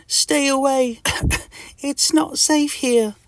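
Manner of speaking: high, slow, coughing squeak; Mister Itch. Acted. Sick Mister Itch warns his friend off, coughing. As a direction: plead high between coughs. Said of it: "coughs Mister Itch".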